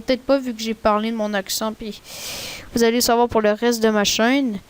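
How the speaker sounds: usual speaking voice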